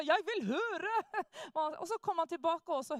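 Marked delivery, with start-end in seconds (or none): 0.0-0.9 higher, louder protesting shout; an addict snapping back at the heckling satanists, loud and higher; retold shout. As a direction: shout it out, insisting you want to listen. Addict shouts to hear the preaching.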